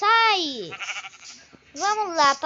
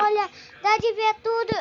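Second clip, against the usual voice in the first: normal talking voice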